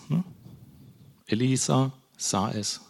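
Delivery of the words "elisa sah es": slow "Elisa ... sah es", words set apart; slow, deliberate repeat. Shown as a demonstration. Preacher drives the key phrase home, slow and deliberate. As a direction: let "Elisa sah es" sink in slowly.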